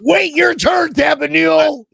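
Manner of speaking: high-pitched, loud playful rebuke; mock scolding shout. Host to co-picker jumping his turn, mock scolding shout.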